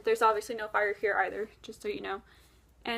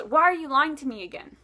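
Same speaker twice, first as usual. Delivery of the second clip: louder, mildly exasperated accusation; mock frustration, pushed a little louder; retold frustration; 3 semitones higher, 7 dB louder than usual. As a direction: play an imitated frustrated questioner. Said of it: "he gets very frustrated".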